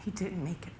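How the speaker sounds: very soft, near-whispered; grave hush with long pauses; hushed bad news. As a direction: deliver the death news barely aloud. Officer breaks news of death.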